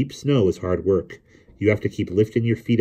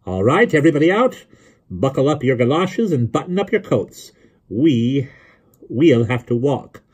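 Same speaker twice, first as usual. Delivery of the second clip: raised, high-pitched rallying call; brisk order; 7 semitones higher, 4 dB louder than usual. A narrator's reading. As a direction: get everybody moving, voice up. Rallies the others to walk.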